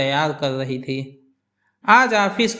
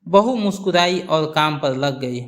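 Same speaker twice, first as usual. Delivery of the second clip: ordinary delivery, nothing marked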